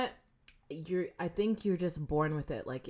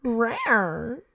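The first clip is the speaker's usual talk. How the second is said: higher, louder mock rawr; playful growl; 6 semitones higher, 8 dB louder than usual. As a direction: growl it like a kitten. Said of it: "That you just made twice".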